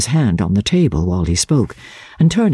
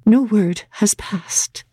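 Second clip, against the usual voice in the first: higher, whispered, slow and resolute; patient, resolute; 9 semitones higher, slower than usual. A narrator's reading. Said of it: "she patiently and resolutely resumed".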